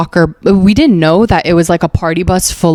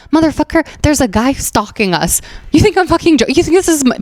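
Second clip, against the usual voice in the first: high-pitched exasperated comeback; retold disbelief, pitch jumping up; indignant retort; 8 semitones higher, faster than usual. Telling a friend there's a guy stalking us, indignant.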